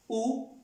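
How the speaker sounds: "U" as French où, one high-pitched oo; French question word. Shown as a demonstration. Teacher showing the word for where, high questioning oo. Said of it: "en pregunta".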